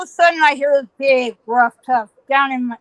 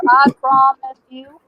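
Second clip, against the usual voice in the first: ordinary talk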